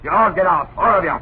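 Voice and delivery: furious shout. Acted. Caretaker screams at the family to get out, furious. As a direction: rage them out of the house. Caretaker ranting, waving hands.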